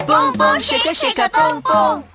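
high, eager repeat; excited. Acted. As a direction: proudly echo it back. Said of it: "你很厉害".

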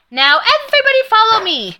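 high, fast call to the class. A narrator's reading. Coach calling out to the whole class, high and fast. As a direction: call the class to follow, bright and loud. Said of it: "she called out".

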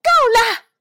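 little mouse finally snaps at the tiger; angry yell. A narrator's reading. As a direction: scream it out in rage. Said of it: "我气得大叫起来".

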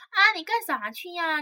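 high, slow, put-on girlish voice; coy teacher voice. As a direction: coo it like a teacher acting young. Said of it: "就这声儿".

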